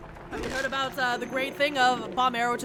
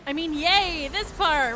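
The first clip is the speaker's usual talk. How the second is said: mock cheer; 3 semitones higher than usual. Sarcastic cheer at boring part.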